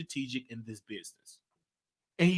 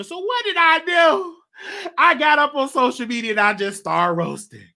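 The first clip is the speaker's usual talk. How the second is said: worked-up storytelling; 10 semitones higher, 8 dB louder than usual. Real, not acted. Building up to the roast story.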